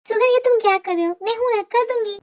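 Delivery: high-pitched motherly voice; fussing mother. Acted. Mother offers to take over son's cooking, fussing.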